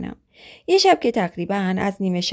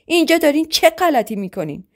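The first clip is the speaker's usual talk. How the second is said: raised, higher-pitched angry yell; shouting; 8 semitones higher than usual. Acted. Husband finding his wife with another man, shouting angrily. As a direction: catch them in the act and shout. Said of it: "داد زد".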